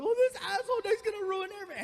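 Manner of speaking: put-on high wailing girlfriend voice, fast; high crying wail; girlfriend sobbing. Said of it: "she starts".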